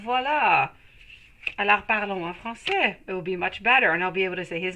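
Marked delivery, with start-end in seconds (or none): 0.0-3.0 voilà and Alors parlons en français said in easy native French; switching to French. Real, not acted. Greeting a French viewer, answering in French. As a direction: slip into French with a light, pleased lift. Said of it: "I said I was gonna say it in French".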